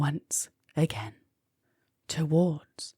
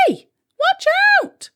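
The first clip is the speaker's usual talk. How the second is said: much higher, louder alarmed shriek; 8 dB louder, faster than usual. A narrator's reading. Molly nearly stepped on by the astronaut, shrieking. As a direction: shriek a warning. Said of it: "shrieked Molly".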